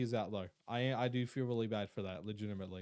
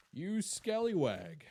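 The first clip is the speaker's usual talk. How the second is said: slow, higher, drawn-out mock scolding; put-on scallywag voice; 4 semitones higher, slower than usual. Streamer testing whether the voice is still there, put-on voice. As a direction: stretch it out in a higher silly voice.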